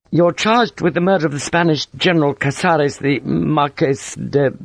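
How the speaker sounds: high-voiced, formal but uneasy; nervous young officer. A narrator's reading. Lieutenant reading Sharp the charge, nervous. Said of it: "came nervously forward".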